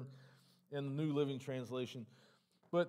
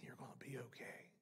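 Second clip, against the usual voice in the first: gentle whisper, much softer and lower; whispered reassurance; 5 semitones lower, 17 dB quieter than usual. Retold or imitated. Preacher whispering God's comfort to the grieving, soft whisper. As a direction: breathe the words like a quiet promise.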